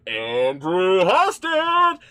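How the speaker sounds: slow, loud, high name announcement; announcer callout. Acted. Announcing patron names.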